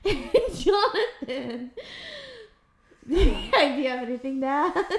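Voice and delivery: real laughter through the words; laughing. Real, not acted. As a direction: giggle out the handoff. Laughing while handing over to husband.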